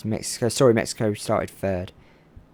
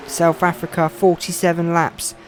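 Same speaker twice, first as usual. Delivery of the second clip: raised, driving play-by-play of the final laps; live race commentary; 4 semitones higher, 4 dB louder than usual. Acted. Commentator calling the final lap to viewers, eager. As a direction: call the race as it unfolds.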